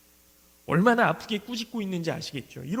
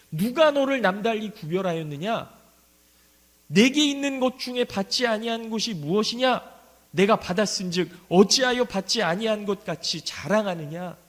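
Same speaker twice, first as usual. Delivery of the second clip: louder, measured Bible reading from the pulpit; solemn scripture reading; 4 dB louder than usual. Acted. Preacher reading Paul's rebuke aloud, solemn and louder. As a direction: proclaim the verses to the congregation.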